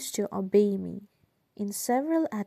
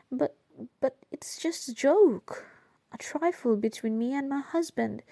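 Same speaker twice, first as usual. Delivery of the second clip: higher-pitched, disbelieving protest; incredulous protest; 5 semitones higher than usual. A narrator's reading. Woman explaining her husband's sketch to the officer, incredulous. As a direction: insist it is harmless, pitch leaping on joke.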